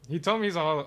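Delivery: normal talking voice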